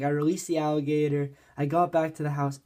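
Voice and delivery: normal talking voice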